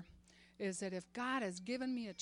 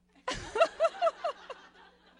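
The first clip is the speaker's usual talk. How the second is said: big high delighted laugh; loud laugh; 13 dB louder than usual. Real, not acted. Speaker laughing at the memory of her mother, loud. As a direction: burst out laughing with joy.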